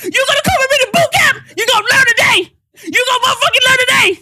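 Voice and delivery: high, loud barking drill sergeant; drill sergeant yelling. Retold or imitated. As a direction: bark it down at the kid. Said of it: "drill sergeant will be like".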